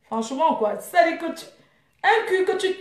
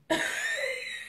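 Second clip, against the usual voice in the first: mocking laugh; 11 semitones higher, 12 dB quieter than usual. Real, not acted. Laughs at her rival.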